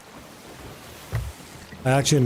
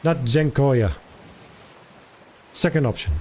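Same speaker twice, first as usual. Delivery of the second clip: usual speaking voice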